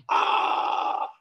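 long breathy falling "aah"; falling cry. Acted. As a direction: cry out as you drop off a cliff. Climber falls, story's cry. (grito al caer)

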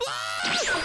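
high sharp shriek; jump-scare yelp; startled scream. Real, not acted. Sudden scare in game.

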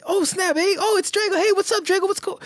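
high-pitched, gushing re-enacted hello; excited greeting. Retelling greeting Drago in the chat, excited greeting. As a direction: greet your pal way up high, tumbling over yourself.

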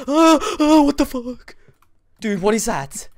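high, loud panicked shriek; terrified scream. Real, not acted. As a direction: shout in sheer fright. Monster appears in horror game.

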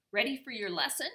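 storyteller's croaky raven character; croaking raven. A narrator's reading. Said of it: "he croaked".

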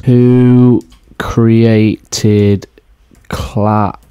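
halting, stretched-out delivery; dramatic drawn-out question. Pretend. Host asking who invented clowns, dramatic drawn-out question. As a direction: break it into slow, separate syllables. Mock dramatic search for the culprit.

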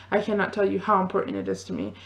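rushed, fast gush of words; racing ahead as the thoughts tumble out; talking too fast. Real, not acted. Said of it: "I'm talking too fast".

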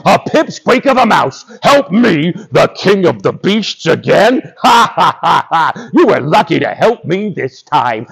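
hammed-up haughty lion with big laughs; mocking lion. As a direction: guffaw scornfully at a tiny creature. Lion ridicules the mouse.

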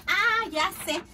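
high, loud exclamation; sudden idea. Acted. Uncle finds a place for the hat, excited outburst. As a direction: burst out with the solution.

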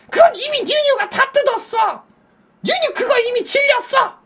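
high, loud scolding voice; shrill wife. Retold or imitated. Wife scolding husband about the gift, high loud scolding. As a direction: snap back at him impatiently.

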